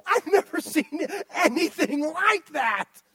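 God marvelling in mock amazement; amazed gasp. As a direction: gasp like you can't believe your eyes. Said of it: "He doesn't look at you and go".

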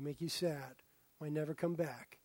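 ordinary delivery

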